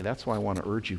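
usual speaking voice

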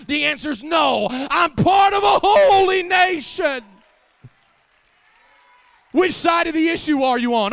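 loud, climbing sermon shout; full-force preaching. Acted. Preacher refusing binary choices, full-force preaching. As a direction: build to a shouted declaration.

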